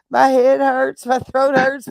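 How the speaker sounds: ordinary talk, nothing marked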